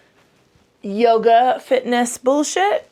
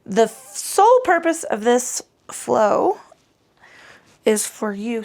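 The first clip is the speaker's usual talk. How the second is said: raised voice; 3 semitones higher, 3 dB louder, slower than usual. Real, not acted. Yoga teacher stating the flow's purpose, nearly shouting. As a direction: project it slowly as if to a big class. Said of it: "Sorry, I'm shouting".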